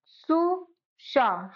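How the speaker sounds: सु and शा stretched far apart; syllables slowly. Shown as a demonstration. Teacher sounding out Sushma slowly for students. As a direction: break Sushma into slow separate syllables. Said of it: "अब देखो सुषमा".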